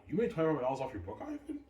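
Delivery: fast, high, incredulous question; shocked disbelief. Retold or imitated. Girlfriend hears his book earned little, shocked disbelief. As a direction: gasp it out in disbelief.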